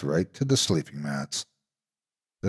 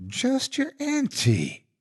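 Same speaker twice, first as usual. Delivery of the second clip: much higher, slow sweet falsetto; fake high voice; slower than usual. A narrator's reading. Monster pretending to be auntie, slow high falsetto. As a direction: monster faking a kindly old auntie. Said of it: "said in a high voice".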